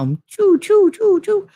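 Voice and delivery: high-pitched playful choo sounds; quill shooting noises. Shown as a demonstration. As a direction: fire off the quills with a sharp choo. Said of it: "those quills come out".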